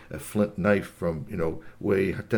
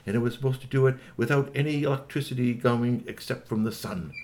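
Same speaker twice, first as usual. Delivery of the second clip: usual speaking voice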